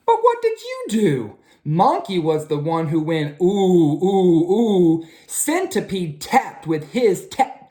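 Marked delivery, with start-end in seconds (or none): 0.1-1.4 high, squeaky mouse voice; the mouse asking, high and squeaky. A narrator's reading. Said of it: "the mouse said". 3.4-5.0 monkey hoots. A narrator's reading. Monkey sound.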